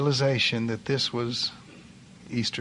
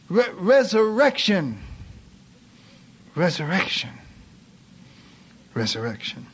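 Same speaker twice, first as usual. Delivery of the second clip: narrated shout, fading; 4 semitones higher than usual. A narrator's reading. Preacher voicing the king's cry to heaven, a restrained shout dying down. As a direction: proclaim it high, then let each repeat sink. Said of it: "he shouted at the top of his voice".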